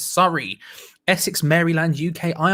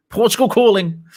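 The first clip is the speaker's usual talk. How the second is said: a hint of a Eurovision results caller, louder and higher; Eurovision announcer; 6 semitones higher, 7 dB louder than usual. Streamer reads viewer's line like Eurovision, light announcer voice. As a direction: call it out like a Eurovision host. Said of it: "It's like Eurovision".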